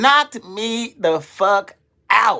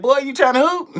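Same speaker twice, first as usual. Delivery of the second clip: mock taunt; 9 semitones higher than usual.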